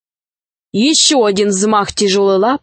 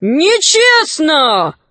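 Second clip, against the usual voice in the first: raised, long-drawn protest; crying out; 6 semitones higher, much slower than usual. A narrator's reading. Caravaners object to the dragon's blow, drawn-out cry. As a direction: protest with a stretched high cry. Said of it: "закричали караванщики".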